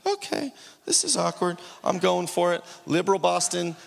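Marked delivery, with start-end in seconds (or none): 0.1-1.6 awkward inner thought. Retold or imitated. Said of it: "I'm like".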